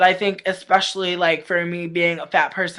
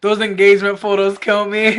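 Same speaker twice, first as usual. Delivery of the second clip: ordinary delivery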